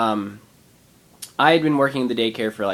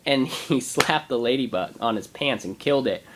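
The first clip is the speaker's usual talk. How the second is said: ordinary talk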